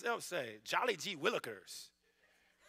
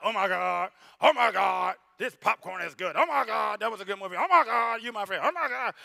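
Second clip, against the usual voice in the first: casual exclaiming; 2 semitones higher, 10 dB louder than usual. Pretend.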